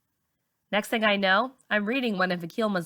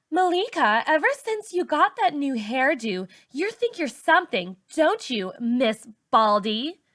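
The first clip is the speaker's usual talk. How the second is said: much higher, mocking sing-song; teasing insult; 6 semitones higher than usual. A narrator's reading. Raina mocks Malika's new hairdo, high and teasing. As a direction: drag out the insult.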